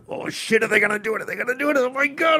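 high, tense whispered panic; tense excitement. Retold or imitated. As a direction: gasp it out on the edge of your seat. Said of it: "being tense and feeling that, like".

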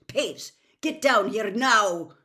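loud, slow, forceful command; stern bellow, each word hammered; barked order. A narrator's reading. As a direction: bark it upward at a troublemaker. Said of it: "barked Professor McGonagall".

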